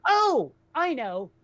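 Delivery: ordinary talk, nothing marked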